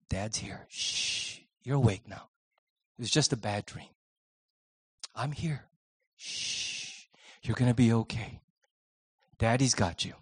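put-on hushed, soft soothing to a frightened child; soft whisper to a child. Father whispering comfort into his child's ear after a nightmare, hushed and soft. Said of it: "I just whisper into their ear".

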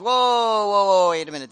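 playing Paul, caught off guard by the news; startled whoa. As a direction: pull up short, alarmed.

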